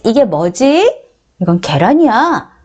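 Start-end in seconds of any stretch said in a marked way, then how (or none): none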